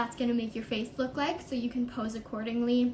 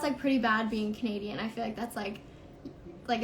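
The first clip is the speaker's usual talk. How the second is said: ordinary talk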